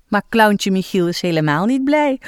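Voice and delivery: ordinary delivery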